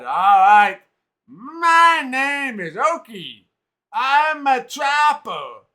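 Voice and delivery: put-on high, loud, very slow Hadati accent; Hadati trapper voice. Oki the trapper tells visitors about the witch, Hadati accent. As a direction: tell the witch trouble slowly with a Hadati accent. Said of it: "heavy Hadati accent".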